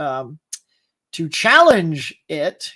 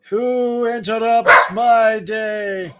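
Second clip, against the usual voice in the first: slow, drawn-out pompous voice speaking for the dog; mock grand dog voice; 2 semitones higher, 3 dB louder, much slower than usual. Voicing his dog at the doorbell, slow and mock-haughty. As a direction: play the indignant dog, stretching every word.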